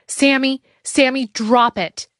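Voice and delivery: high, loud call, slow. A narrator's reading. Anna ordering Sammy to drop the gun, loud call. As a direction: call out firmly to the boy. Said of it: "Anna called out".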